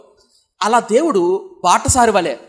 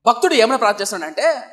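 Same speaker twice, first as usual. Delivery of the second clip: ordinary talk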